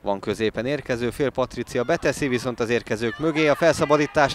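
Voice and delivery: ordinary talk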